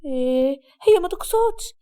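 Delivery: mildly frightened, placating friend; fearful. Acted. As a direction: rise nervously to excuse someone. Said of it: "آمال بخوف". (بخوف خفيف)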